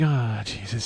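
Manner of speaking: low, soft acted sigh of regret; deflated self-mutter. Man who overstepped stands in line, deflated mutter. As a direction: sink down under your breath.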